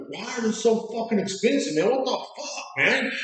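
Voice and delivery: high-pitched mock outrage; imitated cussing rant. Drunk customer complaining about the price, imitated cussing rant. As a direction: mimic an indignant complaint, voice pushed up high. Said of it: "he just starts cussing".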